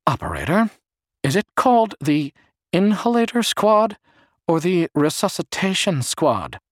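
high, odd, deliberate voice; weird high-pitched voice. A narrator's reading. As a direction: make him sound strange and high, drawing out the question. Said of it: "in a weird, high-pitched, slow voice".

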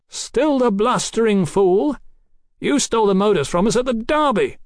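high, projected scorn; scornful berating. Acted. Captain scolds the doctor over the stolen modus, scornful. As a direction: berate a fool across a distance.

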